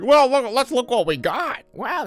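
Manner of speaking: put-on very high, eager kid voice; excited kids. Kids checking the bags after two houses, excited. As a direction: pitch it way up, mock excitement.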